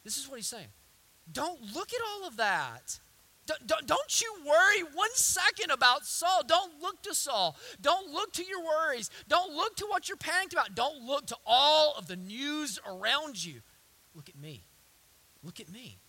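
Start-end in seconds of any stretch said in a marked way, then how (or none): 1.3-13.6 higher, forceful repeated commands; impassioned preaching as God. Acted. 14.2-16.0 low, very soft plea; quiet, intimate call for attention; hushed command. Acted. God's command, dropped to hush.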